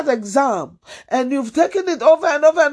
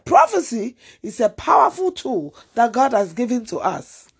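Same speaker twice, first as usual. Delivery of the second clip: normal talking voice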